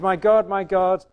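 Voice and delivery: loud, high, slow scripture cry. A narrator's reading. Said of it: "cried out in a loud voice".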